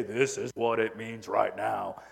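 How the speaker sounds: low, slow booming voice of God; deep divine pronouncement. Pretend. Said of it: "booming voice say".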